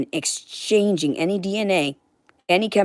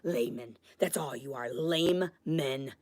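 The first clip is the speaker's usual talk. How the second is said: much softer, low and scornful; muttered contempt; 3 semitones lower, 13 dB quieter than usual. Real, not acted. Mocking the viewers in a soft, low scornful aside. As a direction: sneer it under your breath.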